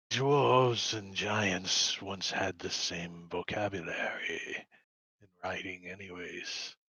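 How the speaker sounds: slow, low serious dwarf; Bill voice. Acted. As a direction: speak it slow and grave. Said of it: "he says".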